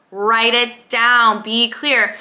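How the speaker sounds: slow, deliberate "Write it down"; slow coaching instruction; slow firm command. Shown as a demonstration. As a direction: spell it out slowly. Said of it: "instructing viewer".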